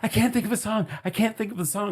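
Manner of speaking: high-pitched mock complaint; imitating flustered friend. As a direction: mimic a friend who is stuck, pitched high.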